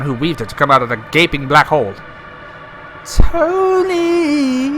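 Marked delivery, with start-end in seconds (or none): 3.0-4.8 very high, long drawn call; ghostly drawn-out call; eerie call. Acted. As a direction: moan the name from the dark. Darkness calls his name.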